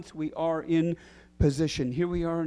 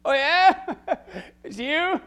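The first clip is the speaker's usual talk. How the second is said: high, loud, cocky challenge; brazen Peter; 13 semitones higher, 9 dB louder, slower than usual. Acted. Peter brashly challenging Jesus from the boat, high and loud. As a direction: dare Jesus like a brash fisherman. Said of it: "brazen, bull-mouthed Peter".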